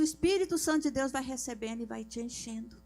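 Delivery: normal talking voice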